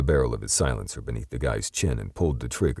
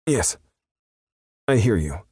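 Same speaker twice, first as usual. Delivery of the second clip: scared yes; 8 semitones higher, 4 dB louder than usual. A narrator's reading. Terrified perp.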